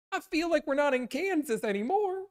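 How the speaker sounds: put-on wide-eyed little girl lost; high Dorothy voice. Reading Oz quote to chat, high girlish wonder.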